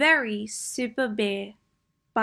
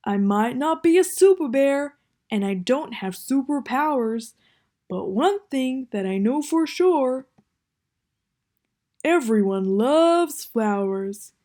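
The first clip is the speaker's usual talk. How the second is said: Bear's voice; 3 semitones higher, 5 dB louder than usual. Acted. Bear speaks.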